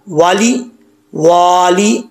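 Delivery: वाली वाली with short first वा, long second वा; short then long. Shown as a demonstration. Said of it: "दूसरे वाव को लंबा करना है".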